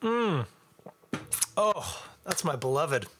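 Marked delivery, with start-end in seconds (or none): 0.0-0.5 appreciative mm. Real, not acted. Savoring the beer.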